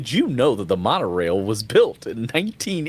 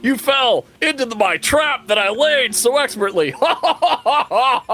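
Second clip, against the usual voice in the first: high, loud villain cackle; evil gloating laugh; 12 semitones higher, 3 dB louder than usual. Acted.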